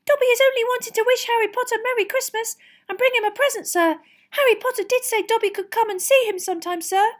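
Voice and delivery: anxious, very high elf squeak; Dobby. A narrator's reading. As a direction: squeak a loud, nervous apology. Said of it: "squeaked Dobby anxiously".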